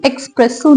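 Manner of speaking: एस्प्रेसो with K before the S, eks-press-o; wrong X sound. Shown as a demonstration. Teacher rejecting the common error, wrong X sound. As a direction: put an X into एस्प्रेसो. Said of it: "नहीं है ये".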